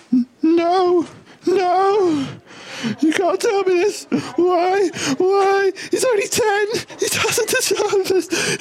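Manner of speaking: very high wailing sob voice; hysterical crying mother. Acted. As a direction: sob it out, falsetto. Mother told son's diagnosis.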